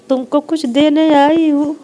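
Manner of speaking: ऊँची, ज़ोरदार देवी की बोली; माता के आने जैसा ऐलान करो; देवी की आवाज़. Retold or imitated. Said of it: "फिर बोलने लगी कि". (बहू से माता जी ऊँची, ज़ोरदार आवाज़ में बोलती)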